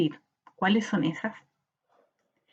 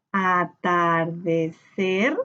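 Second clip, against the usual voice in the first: "atardecer" very slow in even syllables, a-tar-de-cer, rising at the end; 4 semitones lower, much slower than usual. Shown as a demonstration. Teacher splitting the word with pupils, "atardecer" slowly syllable by syllable. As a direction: sound out "atardecer" one syllable at a time. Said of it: "Tenemos primero que separar por sílaba".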